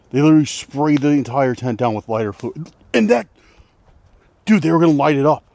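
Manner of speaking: loud, high panicked rush. Real, not acted. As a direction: tell it in rising panic. Panic over lighter fluid.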